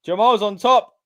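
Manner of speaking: high, loud shouted play-by-play; excited match call. Real, not acted. Commentator calls a splash through the table, excited shout. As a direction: build to a big shout on the slam. Calling the big splash live.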